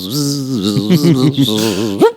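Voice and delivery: imitated buzz darting about; repeated rising and falling zzz; buzzing hummingbird. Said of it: "this little hummingbird comes out and goes".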